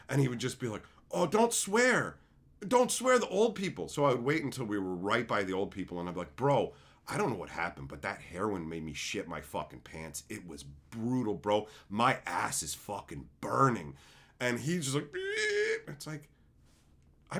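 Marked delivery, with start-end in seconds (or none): 1.1-3.9 high, anxious mock pleading; put-on squeamish whine; fretful scolding. Imitates fretting best friend. 6.4-14.0 low, casual bro talk; lowered, straight-faced mock confession; deadpan provocation. Deadpan provocation near old people. 15.1-15.9 imitated high-pitched beep; mock horrified squeal. Imitates friend's reaction.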